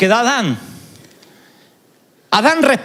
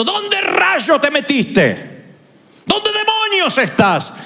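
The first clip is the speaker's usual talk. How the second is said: high, slow exasperated demand; angry shout; 8 semitones higher, much slower than usual. Shown as a demonstration. Preacher shows the wrong tone for God calling Adam, angry shout. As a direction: bark it out like an angry parent. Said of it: "no creo que Dios esté hablando con esa inflexión de voz".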